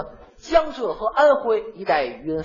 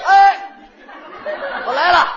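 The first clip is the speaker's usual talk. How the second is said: loud, high, delighted "哎"; happy answer; 11 semitones higher, 8 dB louder than usual. Acted. Answering a friend's call, a loud happy yes. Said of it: "我还特别的高兴".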